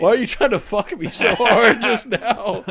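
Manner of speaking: loud, laughing mock protest; laughing outrage. Real, not acted. Laughing at a friend who blocked his pick, loud and amused. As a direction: protest through real laughter.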